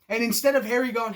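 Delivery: ordinary talk, nothing marked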